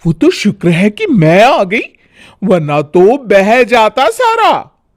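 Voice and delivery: high, know-it-all lecturing tone; preachy bahu. Acted. Bhabhi lecturing Sonam about boiling milk, preachy. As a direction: talk down to her like a teacher.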